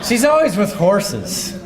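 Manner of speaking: high, slow, laughing through it; amused, drawn-out laugh. Real, not acted. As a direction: laugh the words out. Joking about a friend's title.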